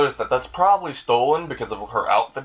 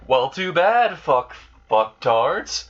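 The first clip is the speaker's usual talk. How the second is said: taunt; 5 semitones higher, much slower than usual. Retold or imitated. Subaru taunting the thugs.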